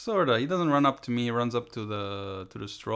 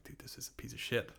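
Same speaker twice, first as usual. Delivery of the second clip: whispered; 12 dB quieter than usual. Retold or imitated. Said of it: "I was like".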